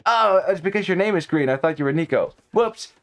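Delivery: ordinary talk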